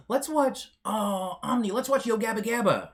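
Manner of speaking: high, loud, eager plea to the chat; mock eager request. Streamer jokingly begging chat to watch a show, high eager plea. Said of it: "I'm joking".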